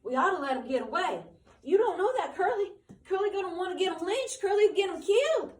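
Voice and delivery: high, loud and urgent pleading; excited. Acted. Candy urging George to let Lenny escape, excited. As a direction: blurt it out in a rush of alarm. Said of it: "But Candy said excitedly".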